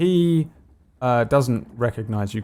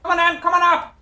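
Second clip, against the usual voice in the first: very high, jeering shout from above; high taunting lookout; 4 dB louder, much faster than usual. Acted. Crow's nest fellow mocking the Goliath, high taunting shout. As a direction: taunt from a safe perch, voice pitched way up. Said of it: "there is a fellow in the crow's nest".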